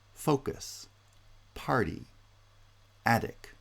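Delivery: "focus", "party", "attic", each high on the first syllable, then falling; stress on first syllable. Shown as a demonstration. Lecturer listing two-syllable nouns, initial stress on "focus", "party", "attic". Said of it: "tend to have initial stress".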